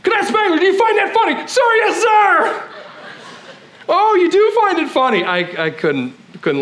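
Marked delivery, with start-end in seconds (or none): 0.0-1.4 high barking drill instructor; drill sergeant. Retold or imitated. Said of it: "he's like". 1.4-2.7 high, laughing sir yes sir; cadet answering. Retold or imitated. Cadet answering drill instructor. 3.9-5.2 high, loud mocking drill instructor; drill sergeant. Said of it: "he's like".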